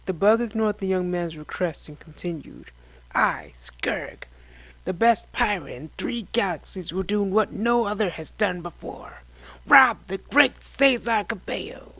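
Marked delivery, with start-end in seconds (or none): 3.1-11.9 grand, swelling pirate boast; boastful alien pirate. Acted. Pirate boasting his plan.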